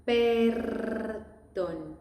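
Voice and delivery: "Perdón" with a long, heavily rolled R; exaggerated rolled R. Shown as a demonstration. Teacher showing learners an overdone trill in "Perdón". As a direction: roll the R in "Perdón" hard and long. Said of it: "I'm exaggerating the R".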